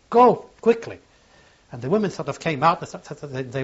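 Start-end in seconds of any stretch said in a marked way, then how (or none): 0.1-1.0 loud, high burst; a sudden raised cry to run; urgent shout. Retold or imitated. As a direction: shout at her to get away now. Urging the woman to flee.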